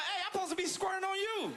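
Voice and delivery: high, laughing confused protest. Retold or imitated. Comic retells protesting to partner, confused and high. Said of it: "I'm like".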